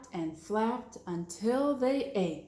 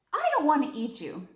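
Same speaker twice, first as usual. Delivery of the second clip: earnest; 6 semitones higher, 5 dB louder, faster than usual. Acted. Max decides firmly.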